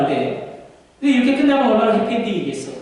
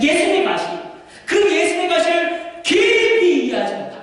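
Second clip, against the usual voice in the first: preaching at full force; 9 semitones higher than usual. Acted. Preacher urging the church, shouting fervently. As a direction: drive each phrase harder.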